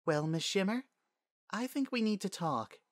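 high, gentle princess voice; composed princess. Acted. Princess pulls up a chair by the filly, composed.

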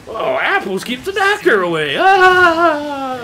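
mock cartoon soldier cheering; cheesy cartoon hero. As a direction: ham up a corny eighties hero catching on. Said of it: "they were like".

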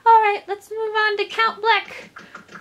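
high, slow, put-on princess; rally them high and slowly; resolute princess voice. Princess moving the party on to Count Bleck, high voice.

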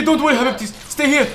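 ordinary talk

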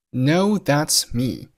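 "No, that's me" slow, every word stressed. Shown as a demonstration. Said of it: "标黄的单词都是重读".

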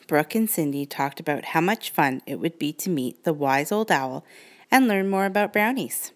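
usual speaking voice

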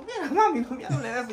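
high, loud shout at his mother; yelling back at mami to stop; retold shout. Son yelling at his mother to drop the subject, loud shout. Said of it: "Yo gritaba".

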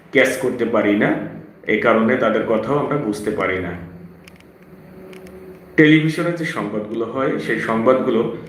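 usual speaking voice

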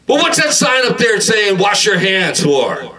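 high comic yell, slowing and dropping; shouted challenge. Acted. Customer to Subway worker about the sign, high shouted challenge. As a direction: yell the question, stretching out the end.

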